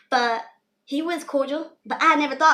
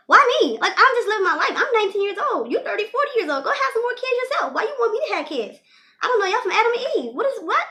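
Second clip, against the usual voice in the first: outraged disbelief; 10 semitones higher, 5 dB louder than usual. Retold or imitated. Inner outrage at the classmate's offer.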